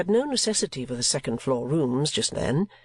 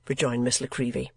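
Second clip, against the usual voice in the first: plain reading voice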